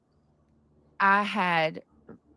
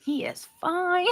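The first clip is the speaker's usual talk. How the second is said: much higher, slow, dreamy; swooning; 11 semitones higher than usual. Retold or imitated. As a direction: draw it out like you're smitten. Swooning over a crush.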